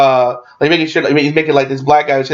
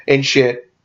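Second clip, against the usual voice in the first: ordinary talk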